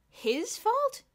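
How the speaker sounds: high, loud incredulous repeat; indignant echo. A narrator's reading. Boots echoing Bruno, indignant and surprised. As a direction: echo it in disbelief. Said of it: "Boots echoed".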